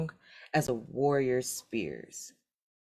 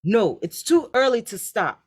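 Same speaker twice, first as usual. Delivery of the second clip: louder, higher objection; called out; 7 semitones higher, 6 dB louder than usual. A narrator's reading. Third son calling out to his brothers in the field, raised voice. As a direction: call it across the field. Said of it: "called the third son".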